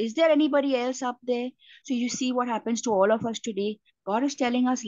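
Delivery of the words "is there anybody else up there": ordinary talk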